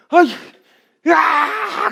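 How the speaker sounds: put-on high loud crying shout like a fussing baby; mock baby cry, high and loud; imitated baby wail. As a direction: bawl like an infant who can't say what's wrong. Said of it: "그냥 우는 거밖에".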